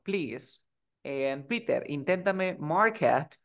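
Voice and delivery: normal talking voice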